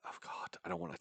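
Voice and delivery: whispered, regretful groan to self; embarrassed whisper. Real, not acted. Dreading telling own teenage story, embarrassed whisper. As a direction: breathe it out under the voice. Embarrassed aside before confession.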